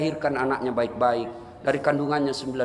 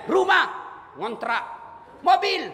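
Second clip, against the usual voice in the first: drawn-out mock answer; 13 semitones higher, slower than usual.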